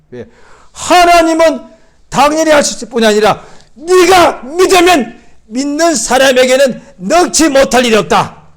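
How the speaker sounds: loud, high, fired-up preaching; forceful preaching. Acted. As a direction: preach it out at the top of your voice.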